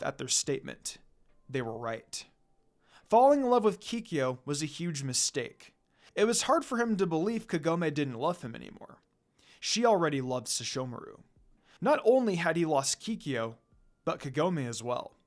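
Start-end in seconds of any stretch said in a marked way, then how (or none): none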